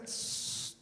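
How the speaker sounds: ordinary delivery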